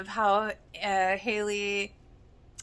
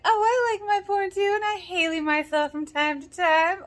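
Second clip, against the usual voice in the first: mock Haley; 10 semitones higher, 4 dB louder than usual. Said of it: "pretending to be Haley".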